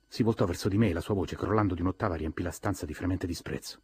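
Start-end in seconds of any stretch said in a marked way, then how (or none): none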